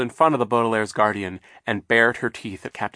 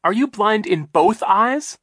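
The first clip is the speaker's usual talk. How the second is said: incredulous; 12 semitones higher, 8 dB louder, slower than usual. Acted. Klaus incredulous.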